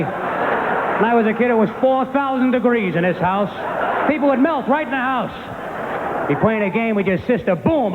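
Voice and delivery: high-pitched, hammed-up dad tirade; mock indignant dad, stretching the boasts; ranting father. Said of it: "My father'd be like".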